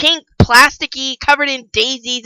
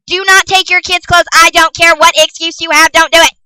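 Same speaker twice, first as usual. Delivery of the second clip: higher, emphatic rant, word by word; insistent scolding; 4 semitones higher, 4 dB louder than usual. Real, not acted. Vlogger ranting at parents, insistent scolding. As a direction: lay down the law, getting louder.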